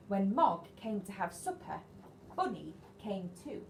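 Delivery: ordinary delivery, nothing marked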